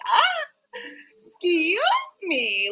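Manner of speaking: high gasped "ah", then stretched words; mock affronted. Reader to a commenter who judged her, mock affronted. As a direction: act scandalized, starting high and dropping. Acted reply to being condemned.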